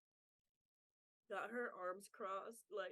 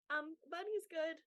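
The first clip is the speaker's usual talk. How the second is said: put-on shy girl; 6 semitones higher, 3 dB louder than usual. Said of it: "she's like".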